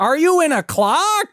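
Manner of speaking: very high, loud baffled holler; hammy nasal shouting impression; David Lynch. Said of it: "David Lynch doesn't know what the fuck TikTok is".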